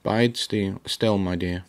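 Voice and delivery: usual speaking voice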